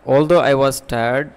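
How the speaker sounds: slow and loud model sentence; deliberate slow example. Shown as a demonstration. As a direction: read the example out slowly and clearly. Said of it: "একটা complex সেন্টেন্সের উদাহরণ এখানে দিছি আমি".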